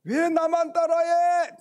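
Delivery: high, loud yell across a valley; loud calling protest; indignant shout. Retold or imitated. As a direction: holler it at an unseen copycat. Child yells at the echo.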